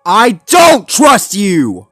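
slow, high, emphatic yell; loud, high outburst of distrust; furious shout. A narrator's reading. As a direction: shout each word in defiance. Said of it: "baring his teeth".

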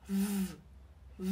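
voiced V held as a long buzz; V rung cổ. Shown as a demonstration. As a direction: hum the V sound with the throat vibrating. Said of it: "rung cổ này". (âm V rung dây thanh, kéo dài)

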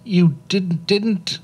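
put-on small boy stammering in fright. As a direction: trip over the words like a scared child. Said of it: "stammered Nye".

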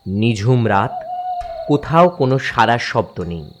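usual speaking voice

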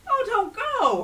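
high, loud, begging plea; urgent high-pitched pleading. A narrator's reading. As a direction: beg the guest to stay. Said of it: "Herschel pleaded".

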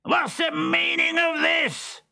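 very high, slow outraged shout; thundering father. Acted. Pantalone squashed flat, shouting in outrage. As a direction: demand an answer. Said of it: "he thundered".

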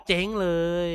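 high, loud cry of dismay; excited groan. Real, not acted. Gamer crying out that his run is ruined. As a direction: wail that it's all ruined.

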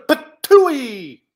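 high, loud patooey; spit-out effect. Acted. Hero shooting out of a hole, patooey effect. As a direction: spit the sound out explosively.

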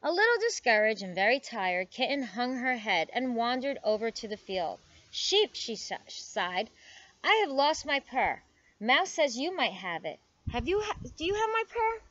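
animated storybook reading with lively pitch swings; story read to children. A narrator's reading. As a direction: read it aloud for little listeners. Reads picture book aloud to children.

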